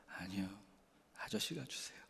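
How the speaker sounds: put-on a soft, nearly whispered child's reply. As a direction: whisper it shyly like a small child. 아이가 아저씨에게 대답함. (수줍게 속삭이는 아이 목소리)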